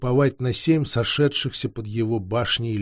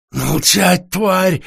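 louder, venomous snarl; furious hiss; 5 semitones higher, 5 dB louder, much slower than usual. Acted. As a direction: spit it at him in rage. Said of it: "яростно зашипел".

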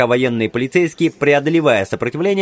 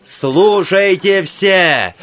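slow high shout for attention; loud call; 6 semitones higher, 4 dB louder, much slower than usual. A narrator's reading. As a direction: call the whole hall to listen. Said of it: "размахивая руками, требовал внимания".

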